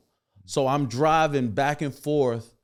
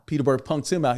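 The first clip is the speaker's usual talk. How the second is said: usual speaking voice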